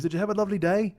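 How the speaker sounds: high imitated English accent; Paul. Flatmate asking about his day, put-on English accent. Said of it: "your English accent is shit".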